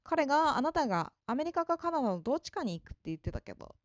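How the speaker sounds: usual speaking voice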